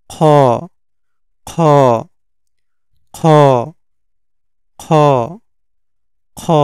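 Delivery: "খ" scraped from the throat's end, held one beat; back-of-throat sound. Shown as a demonstration. Teacher drills the letter, "খ" from back of throat. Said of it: "এরকম".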